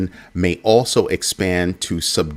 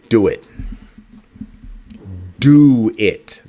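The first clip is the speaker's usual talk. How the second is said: louder, punchy push; urging shout; 3 semitones higher, 6 dB louder, slower than usual. Real, not acted. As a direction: hype them up to do it. Urging viewers.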